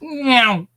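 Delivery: high drawn-out cat meow. Acted. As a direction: meow like a passing motorbike. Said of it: "When motorbikes go past".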